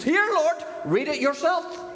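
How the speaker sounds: high and loud, thrusting the Bible up; daring God to read it himself; defiant challenge. Retold or imitated. Woman holding Bible up to the Lord, bold and defiant. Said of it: "with her Bible up in the air".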